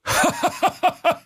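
high, giddy fox laugh; fox cackle. Acted. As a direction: burst out in a squeaky fit of laughter. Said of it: "rollte sich auf den Boden vor Lachen".

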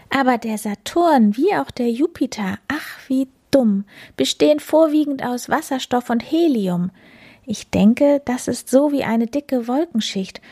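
hohe, verträumte Katzenstimme; erklär es hoch und träumerisch; Plapperkatze. Acted. (verträumt erklärende Katzenstimme)

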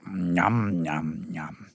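low, satisfied yum-yum noises; smacking lips. A narrator's reading. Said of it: "schmatzt er". (genüsslich schmatzend)

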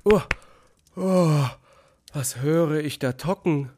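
high, loud frightened cry; jolted awake by a knock and scared; startled fright. Acted. Vanja woken in the storm, frightened and high. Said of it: "So fragt er sich erschrocken".

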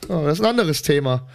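ordinary talk, nothing marked

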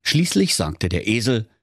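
ordinary delivery

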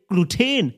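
put-on Gluten with a long stressed second syllable, glu-TEEN; French-sounding. Shown as a demonstration. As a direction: make Gluten chic, ending in a drawn-out "téén". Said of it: "Klingt sofort französisch".

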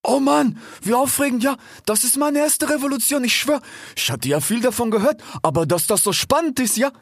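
very high, thrilled animal voice; excited Ilias. A narrator's reading. Ilias bounces round the foxes, high excited chatter. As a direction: gush with excitement, pitched right up. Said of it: "sprang aufgeregt um die beiden Füchse umher und rief".

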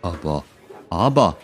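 halting, restarted word; acted mild stutter; stammering. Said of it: "stotterte Harry".

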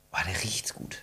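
whispering. Real, not acted. Sniffing apple, hushed.

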